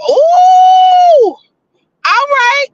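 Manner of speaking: high loud squealing ooh; excited squeal. Real, not acted. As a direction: squeal it out with delight. Reacts to a vote.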